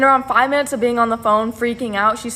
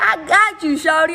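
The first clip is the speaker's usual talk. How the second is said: higher and louder, playful friend voice; voicing her friend; 7 semitones higher, 4 dB louder than usual. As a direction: toss it off like a hyped-up friend.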